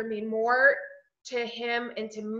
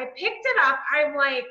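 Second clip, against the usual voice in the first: normal talking voice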